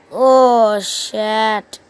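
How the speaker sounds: a sudden loud oh shit; loud curse. Real, not acted. Gamer yelling at a failed attack, loud. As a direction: blurt it out as things go wrong. Gaming mishap outburst.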